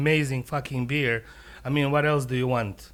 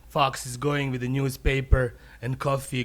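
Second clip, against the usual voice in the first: ordinary delivery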